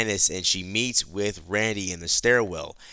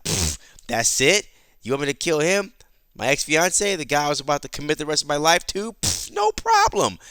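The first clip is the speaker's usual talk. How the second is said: higher-pitched dismissive woman's voice; feigned nonchalance; 6 semitones higher than usual. Acted. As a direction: brush it off in a higher, mock-casual voice with dismissive puffs. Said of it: "her best poker face".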